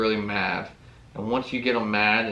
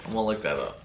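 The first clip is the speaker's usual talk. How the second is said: ordinary talk, nothing marked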